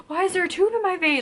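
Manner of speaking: high, loud panicked cry; freaking out. Retold or imitated. Patient sees a tube in her vein, panicked high cry. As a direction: sound alarmed and panicky. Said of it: "I started freaking out".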